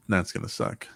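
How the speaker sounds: a hushed, unvoiced grumble; whispered complaint about the remake; whispered dread. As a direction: whisper it under your breath. Repeats his complaint, varied.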